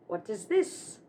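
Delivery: normal talking voice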